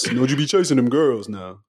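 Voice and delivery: lightly voiced warning uncle. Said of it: "That's, that's the uncle voice".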